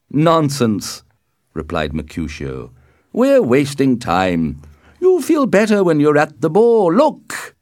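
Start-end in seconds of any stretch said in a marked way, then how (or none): 0.1-1.0 loud, higher dismissal; impatient, raised-pitch retort. A narrator's reading. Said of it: "was getting impatient". 3.1-7.5 loud, high and insistent; impatient, building to a high loud call; impatient urging. A narrator's reading. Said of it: "was getting impatient".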